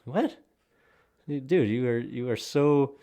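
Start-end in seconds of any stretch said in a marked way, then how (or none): 0.0-0.4 high, rising incredulous "What?"; stunned disbelief at the hypocrisy. Real, not acted.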